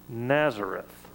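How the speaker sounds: usual speaking voice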